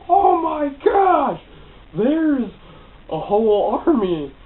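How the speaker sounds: high, slow, hammed-up yell; alarmed shout. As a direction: cry out a drawn-out movie warning. Acts out alarm at an army.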